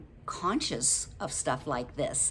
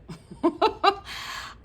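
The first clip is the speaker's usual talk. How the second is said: a bright high burst of laughter; quick self-amused laugh; 3 dB louder than usual. Real, not acted. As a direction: laugh at yourself mid-point. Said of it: "laughs at own remark".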